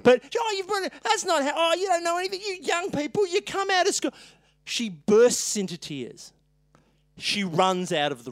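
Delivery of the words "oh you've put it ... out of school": high, huffy scolding of a clumsy junior; mock angry scolding. Cranky dentist scolds his young assistant, huffy and high. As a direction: pitch it up and berate the newbie.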